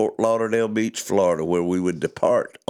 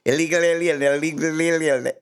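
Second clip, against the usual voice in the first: "illegal alien" rattled off high and run together; fast alien babble; 6 semitones higher than usual. Shown as a demonstration. Host demonstrating saying it three times fast, high alien babble. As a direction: gabble "illegal alien" so the words blur. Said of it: "three times fast and you actually sound like an alien".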